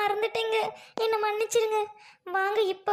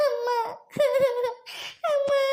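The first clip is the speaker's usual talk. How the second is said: put-on higher, tearful cry of a lost child; child sobbing; 4 semitones higher, slower than usual. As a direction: wail for amma through tears. (அழுதபடி அம்மாவை அழைக்கும் குழந்தை)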